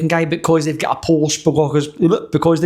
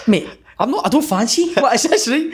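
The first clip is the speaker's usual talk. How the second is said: higher-pitched, baffled retelling; puzzled, raised-pitch objection; confused protest; 6 semitones higher than usual. Retold or imitated. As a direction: act out being thrown by an odd question. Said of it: "I was well confused".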